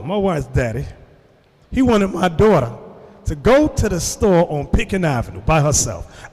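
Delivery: ordinary delivery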